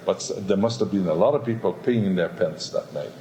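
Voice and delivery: ordinary talk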